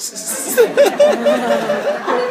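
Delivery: high loud burst of laughter; big laugh. Real, not acted. Laughing at friend's chin-wrapping suggestion, big high laugh.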